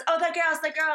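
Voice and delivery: high, loud imitation of a castmate; Shauna. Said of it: "She goes".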